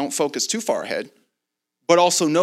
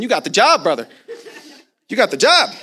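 loud, higher, jubilant announcement; excited declaration; 6 semitones higher, 6 dB louder than usual. Retold or imitated. Preacher hands his searching friend the job, excited. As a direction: punch out the good news to a brother. Telling friend he's saved material.

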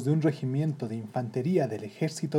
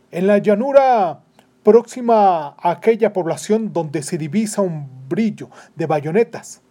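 urgent orders; 3 semitones higher, 8 dB louder than usual. Acted. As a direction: rattle off battle orders forcefully. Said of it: "dijo el capitán".

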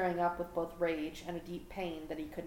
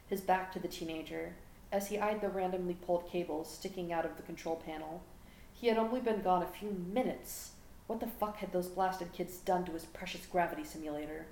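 ordinary delivery

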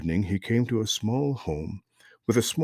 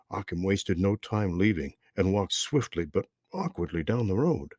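plain reading voice

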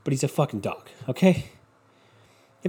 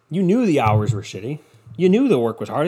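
ordinary delivery

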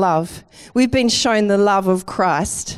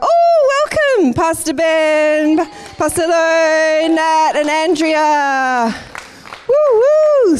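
much higher, louder joyful greeting, slowing on the names; excited welcome; 7 semitones higher, 4 dB louder, slower than usual. Real, not acted. Pastor greeting the Pilbara team as they walk in, loud excited welcome. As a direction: call out a big happy welcome to arriving guests. Visiting team arrives mid-talk.

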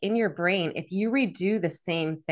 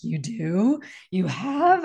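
surprised; much slower than usual. Retold or imitated. Parents surprised she yells.

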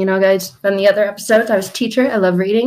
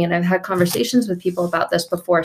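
ordinary talk

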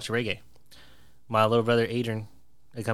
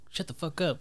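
higher-pitched exasperated retort, not shouted; retold angry comeback; 7 semitones higher, 3 dB quieter than usual. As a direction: act out snapping back without raising volume. Retelling his angry comeback.

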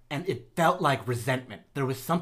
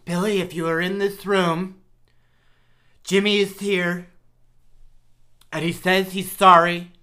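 raised, louder voice calling a spirit; séance medium; 3 semitones higher, 5 dB louder than usual. Pretend. Medium calls out to dead snake.

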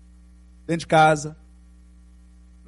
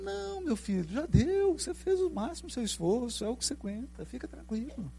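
gentle reassurance; 6 semitones higher, 9 dB quieter than usual. Retold or imitated. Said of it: "Ele falou".

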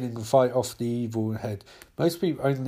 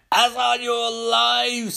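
slow, much higher drawn-out warning; ominous warning; 9 semitones higher, much slower than usual. Acted. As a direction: stretch each word like a grave omen. Martian Manhunter's ominous warning.

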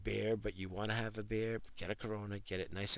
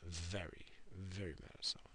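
very mad at someone, whispered much softer; half-joking whispered grudge; 3 semitones lower, 11 dB quieter than usual. Shown as a demonstration. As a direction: drop to a hush as if sharing a secret. Repeats it in a whisper.

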